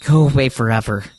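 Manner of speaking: loud, high, raging yell; furious screaming. Acted. Takuru screaming at the voices in his head to vanish, furious and loud. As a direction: scream them all out of your head.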